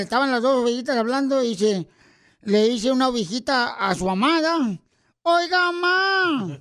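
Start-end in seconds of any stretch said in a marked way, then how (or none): 5.2-6.6 put-on high, drawn-out child's call; sheep calling mama. Little sheep to its mother, drawn-out call.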